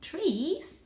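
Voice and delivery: voiced high and light as a young cat; a proud little cat boasting. A narrator's reading. Young Varjak boasting his breed to the stray.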